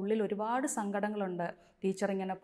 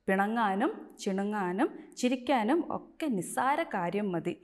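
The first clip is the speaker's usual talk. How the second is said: louder, measured reading of the lesson text; textbook read-aloud; 5 dB louder than usual. A narrator's reading. Teacher reading the lesson aloud to the pupils, loud and clear. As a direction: read the passage out to the class.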